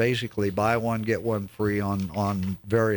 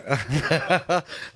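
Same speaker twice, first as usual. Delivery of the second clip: short real laugh; a quick laugh at own joke; 7 semitones higher than usual. Real, not acted.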